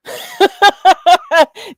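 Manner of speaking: loud, high burst of laughter; derisive laughter. Real, not acted. Streamer laughs at rival's muddled claim, loud derision. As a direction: crack up loudly at the nonsense.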